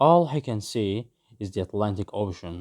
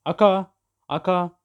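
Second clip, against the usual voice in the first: calling out; 7 semitones higher, 3 dB louder than usual. A narrator's reading. Felix calls for his friend.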